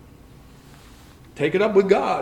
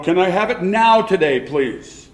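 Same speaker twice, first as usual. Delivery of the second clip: usual speaking voice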